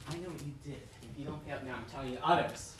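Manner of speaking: very soft, low blackmail threat; hushed threat. Acted. Blackmailer to the victim at a secret meeting, hushed menace. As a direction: keep the threat down low and quiet.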